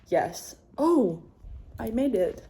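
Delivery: ordinary talk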